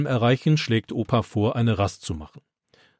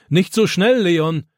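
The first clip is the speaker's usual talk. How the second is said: put-on louder, higher call after the boy; 6 semitones higher, 4 dB louder, slower than usual. A narrator's reading. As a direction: call ahead up the trail. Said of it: "ruft Opa ihm nach".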